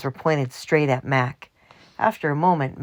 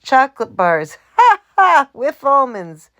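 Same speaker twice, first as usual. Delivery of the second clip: laughing; 8 semitones higher, 9 dB louder than usual. A narrator's reading. Mac laughing roll call.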